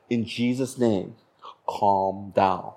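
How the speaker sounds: slow, low, soft command; calm someone with a quiet prayer; hushed, unhurried authority. Retold or imitated. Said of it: "lowering my voice, telling her to calm down".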